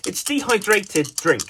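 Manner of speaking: ordinary talk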